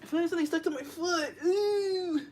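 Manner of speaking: high, loud startled cry. Real, not acted. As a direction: yelp in surprise. Something stuck to foot.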